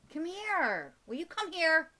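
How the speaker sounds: loud, high call to a pet. Real, not acted. Calling the bird to come back.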